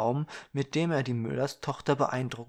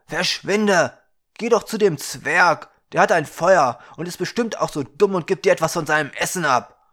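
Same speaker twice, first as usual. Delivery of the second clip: much louder, higher, fast scornful rant; angry shout; 4 semitones higher, 10 dB louder than usual. Acted. Young man shouting the girl away to the dwarf, loud and scornful. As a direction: get rid of her, mocking the dwarf.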